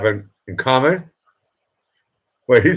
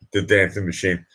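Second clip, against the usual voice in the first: usual speaking voice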